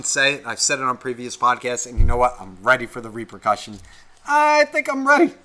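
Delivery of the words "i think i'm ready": very high, playful voice; squeaky mock readiness. Podcaster bracing for repercussions, squeaky mock readiness. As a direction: pitch it way up in mock uncertainty.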